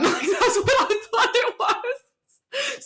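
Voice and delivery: high, loud, slow laughing speech. Real, not acted. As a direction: crack up while talking, voice shooting up. Cracks up after own robot impression.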